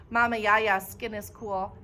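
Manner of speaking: usual speaking voice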